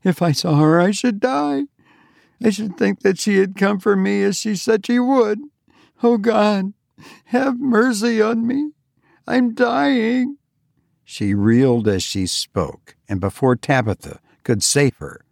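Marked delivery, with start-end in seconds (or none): 0.0-10.4 high, terrified pleading to God; terrified fainting. A narrator's reading. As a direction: beg for mercy as she collapses. Eunice collapses in terror.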